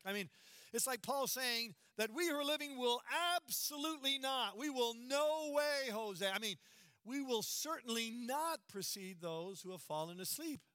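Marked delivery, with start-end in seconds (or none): 4.6-6.3 no way, Jose pushed out louder and higher; emphatic. Shown as a demonstration. Preacher stressing Paul's certainty, emphatic. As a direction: punch no way, Jose. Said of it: "it's super emphatic".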